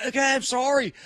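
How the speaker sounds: put-on a scared teen caving in; panicked apology. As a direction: squeal out a frantic sorry. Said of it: "So I was like".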